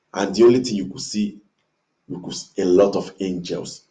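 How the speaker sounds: usual speaking voice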